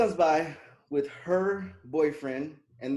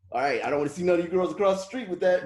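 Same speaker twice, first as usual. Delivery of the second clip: loud mock warning; 4 semitones higher, 7 dB louder than usual.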